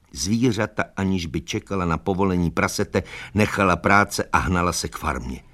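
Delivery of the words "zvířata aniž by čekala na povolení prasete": usual speaking voice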